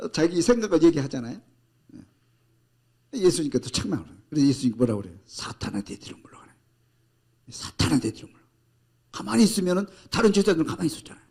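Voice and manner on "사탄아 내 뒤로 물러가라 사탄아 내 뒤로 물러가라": hushed, breathless command; whispered rebuke. Acted. Jesus rebuking Peter in a hushed whisper. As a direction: whisper it low and firm.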